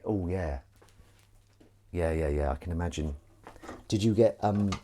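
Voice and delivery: ordinary talk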